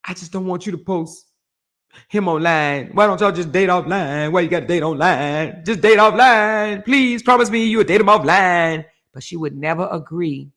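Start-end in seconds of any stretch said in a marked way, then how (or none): 0.0-8.8 odd pleading voice, set apart. Retold or imitated. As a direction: beg her to keep him offline. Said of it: "talking and".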